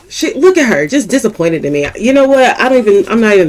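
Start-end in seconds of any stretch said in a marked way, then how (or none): 2.0-3.5 louder, exasperated; exasperated outburst. Real, not acted. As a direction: throw up your hands at the doll. Exasperated at companion Poppy.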